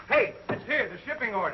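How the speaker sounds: excited. Acted. Shipping order arrives, called out.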